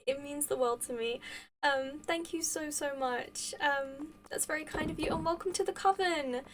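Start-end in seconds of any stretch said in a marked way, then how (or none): none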